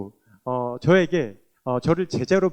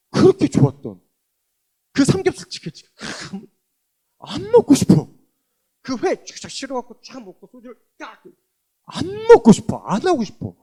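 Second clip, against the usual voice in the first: higher and louder, wondering exclamation; amazed delight; 8 semitones higher, 8 dB louder than usual. Real, not acted. Telling the church the craving vanished, amazed and exclaiming. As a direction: marvel that the craving is gone.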